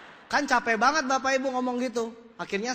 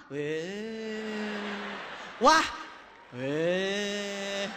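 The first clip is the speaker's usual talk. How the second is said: short "wah", long low "weh"; deflated crowd; 3 semitones lower, 3 dB quieter, much slower than usual. Retold or imitated. Audience hears the evasive answer, drawn-out mock letdown. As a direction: mimic hope popping then sagging. Crowd reaction repeated for laughs.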